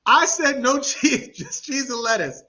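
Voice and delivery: laughing through the words; cracking up mid-sentence. Real, not acted. Friend retelling the taco mix-up, laughing.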